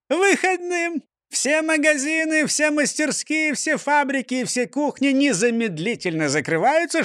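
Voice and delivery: high jolly mayor voice; beaming, puzzled mayor; cheerful mayor. A narrator's reading. Mayor voice again.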